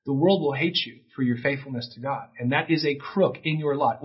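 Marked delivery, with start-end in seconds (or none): none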